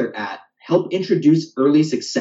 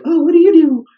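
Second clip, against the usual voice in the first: high, loud mock-curious passenger; voice imitation of passengers; 8 dB louder than usual. Rideshare passenger asking the driver about work, high imitation voice. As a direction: put on a much higher questioning voice. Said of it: "why I have the voice imitations".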